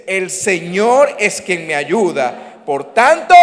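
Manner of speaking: slow, loud group recital; congregation reading aloud. Shown as a demonstration. Pastor leading the congregation's reading, slow and loud. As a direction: lead the crowd through it slowly, building. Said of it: "Uno, dos y tres".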